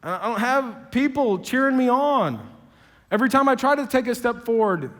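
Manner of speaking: a hurt Moses listing who won't back him; Moses pleading. Retold or imitated. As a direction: plead every excuse, building to a cry. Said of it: "Moses says".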